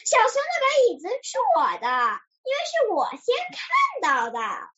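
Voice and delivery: quick high-pitched complaint; indignant protest. A narrator's reading. Duck claims bear's chair, high indignant protest. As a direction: insist in a high, aggrieved voice. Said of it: "他大声叫道".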